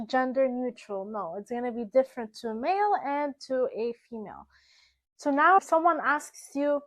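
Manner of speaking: usual speaking voice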